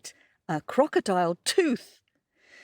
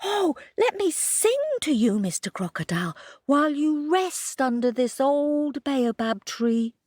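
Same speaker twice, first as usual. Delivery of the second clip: 1 eager rabbit; 6 semitones higher, faster than usual. A narrator's reading. Kojo offers to sing.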